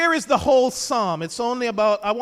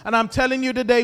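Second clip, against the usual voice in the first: ordinary talk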